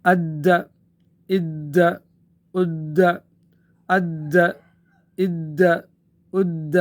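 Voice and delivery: اد held hard with a firm bounce on the doubled د; doubled qalqalah. Shown as a demonstration. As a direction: press the mushaddad د of اد hard, then release it. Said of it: "سختی اس میں آ جائے گی". (مشدد دال، سختی کے ساتھ قلقلہ)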